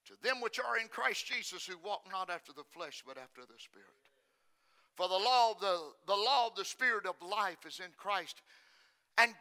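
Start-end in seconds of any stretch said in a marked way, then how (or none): 0.1-3.9 soft, low, trailing away; quiet reading of scripture; fading to hush. Real, not acted. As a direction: let the words fall to near silence.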